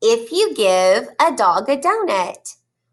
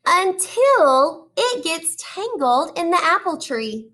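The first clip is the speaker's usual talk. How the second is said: put-on reading to children; 2 semitones higher than usual. Teacher reading a picture book to her class, animated storytelling. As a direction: draw the kids into each page.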